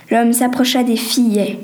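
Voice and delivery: ordinary delivery, nothing marked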